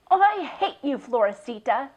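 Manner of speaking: high, loud hateful declaration; angry repeat. A narrator's reading. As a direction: spit it at the goat again. Slams gate, repeats angrily.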